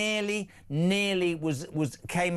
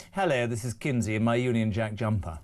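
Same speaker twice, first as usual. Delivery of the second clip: low-pitched English accent demo; 7 semitones lower than usual. Shown as a demonstration. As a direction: model the line in an English accent. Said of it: "Can we do an English one?".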